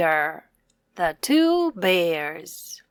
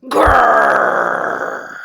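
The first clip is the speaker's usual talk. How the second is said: big bear growl, very low and loud; deep growl; 8 dB louder than usual. Acted. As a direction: growl to scare the birds. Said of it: "took a deep breath and growled".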